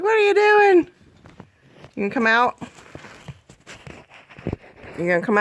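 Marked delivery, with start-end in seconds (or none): 0.0-0.9 high squeaky cat voice. Retold or imitated. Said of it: "She's like".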